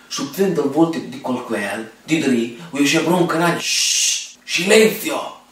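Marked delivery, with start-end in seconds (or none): 3.6-4.4 shushing. Retold or imitated. Hushing the family before TV. 4.4-5.4 call for silence. Retold or imitated. Demands silence for TV.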